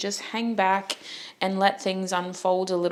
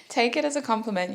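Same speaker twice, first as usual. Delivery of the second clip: high giggle running into quick words; giggly, higher-pitched and quick; laughing; 7 semitones higher, faster than usual. Real, not acted. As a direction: talk through your laughter. Laughing at own joke.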